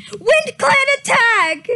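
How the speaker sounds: sudden raid warning, shouted; alarm shout. A narrator's reading. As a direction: yell the alarm down into the camp. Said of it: "Shouted a voice from above the camp".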